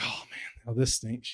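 hushed, breathy "oh man" under the breath; dismayed mutter. Retold or imitated. Pastor imitating himself finding the dead puppy, hushed dismay. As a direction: sigh it out to yourself, let down.

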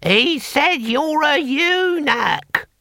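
very high, slow, drawn-out holler; stretched-out high bellow; bellowed into deaf ear. A narrator's reading. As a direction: spell it out for someone hard of hearing. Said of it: "Boy Willie bellowed in Hamish's ear".

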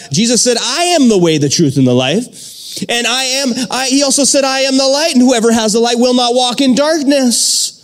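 fervent pulpit declaration; rising sermon proclamation. Acted. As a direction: lift it up like a preacher declaring truth. Preaching builds to proclamation.

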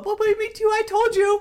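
high, pleading retold voice; mock high whine. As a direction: whine it like a child. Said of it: "I was all".

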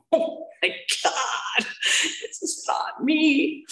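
breathy, high, very slow whisper of dismay; horrified. Retold or imitated. Newcomer recoiling at drooling patients, horrified slow whisper. Horrified at the mental hospital meeting.

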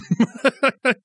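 high burst of laughter; laughing. Real, not acted. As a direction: laugh at your own joke. Laughing after impression.

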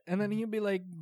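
normal talking voice

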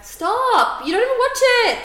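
loud, high exasperated outburst; half-laughing loud rant at singlet-wearers; exasperated shout. Real, not acted. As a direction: yell stop at them, laughing. Mocking Aussie NBA fans.

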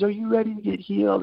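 high, excited rallying cry; Caleb screaming. Retold or imitated. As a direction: imitate firing up a crowd. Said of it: "he's screaming".

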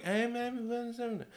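soft, high mumble; faint wordless-sounding babble; imitated muffled speech. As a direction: mimic indistinct talk from another room. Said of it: "heard you go like".

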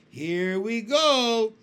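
slow, loud, high hollering; excited shout. A narrator's reading. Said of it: "shouted the old green grasshopper".

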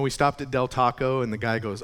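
ordinary delivery

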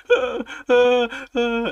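high, loud mock wails of a crying kid; imitated bawling. Little kid hit in the face, mock wailing. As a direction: wail like a kid hit by a ball. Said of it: "so I'm, like, bawling my eyes".

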